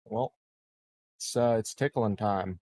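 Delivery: ordinary talk, nothing marked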